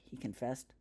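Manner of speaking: ordinary delivery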